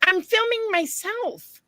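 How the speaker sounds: high, slow insistent protest; defensive protest. Retold or imitated. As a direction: insist to the staring man. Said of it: "I'm like".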